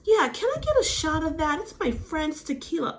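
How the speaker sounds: mock Rena. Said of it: "Rena's like".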